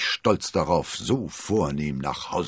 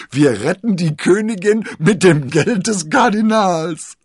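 much higher and louder, exultant cry; triumphant; 13 semitones higher, 12 dB louder than usual. A narrator's reading. D'Artagnan jubelt Constanze triumphierend zu. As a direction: crow with glee at the joke.

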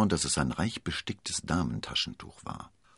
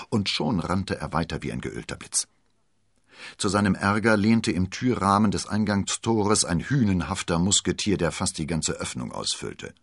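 ordinary delivery

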